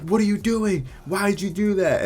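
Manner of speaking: high-pitched mock outrage; alarmed scolding. Nurses confronting the man who spat blood, alarmed scolding. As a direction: imitate staff crying out in disbelief.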